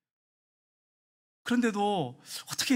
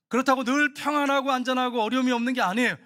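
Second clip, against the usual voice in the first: higher, louder sermon cry; full-force preaching; 7 semitones higher, 6 dB louder than usual. Real, not acted. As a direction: preach it out to the congregation.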